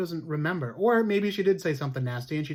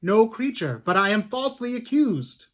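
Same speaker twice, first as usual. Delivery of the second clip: accused woman pleading; 5 semitones higher, 5 dB louder than usual. Acted. Sarah Good answers court accusation.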